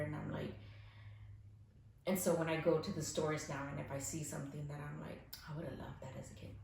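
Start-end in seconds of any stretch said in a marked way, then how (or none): 5.5-6.5 quick, hushed whisper; whispered thought. Retold or imitated. Whispering to herself in the store about a toy. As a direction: breathe it out as a private wish.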